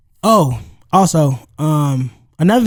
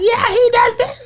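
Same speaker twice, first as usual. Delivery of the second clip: quoting wife. Retold or imitated. Wife explaining the stranger.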